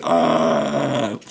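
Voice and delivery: brief mock jungle cry; a short swinging-monkey call. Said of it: "Tarzan was doing it like the monkeys".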